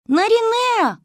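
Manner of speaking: high, loud drawn-out call of the name; distant desperate call. A narrator's reading. A voice calls the girl from far away in the forest, a high, loud call. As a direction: call out across the dark forest. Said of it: "захлёбываясь криком".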